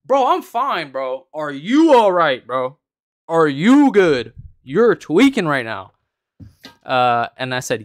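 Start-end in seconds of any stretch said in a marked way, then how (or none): 0.0-1.3 exaggerated indignation. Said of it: "I was exaggerating a little bit for a video". 1.3-5.9 loud, slow, higher mock concern; hammed-up jeering, louder and drawn out; exaggerated taunting. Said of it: "I was exaggerating a little bit for a video".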